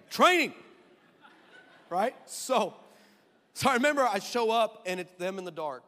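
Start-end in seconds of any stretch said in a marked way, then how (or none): 0.1-0.5 high, loud repeat of Training; emphatic shouted word; loud punch. Shown as a demonstration.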